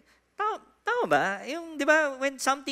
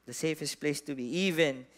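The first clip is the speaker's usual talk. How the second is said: prayer; 8 semitones lower, 5 dB quieter than usual. Acted. Pastor prays over the congregation.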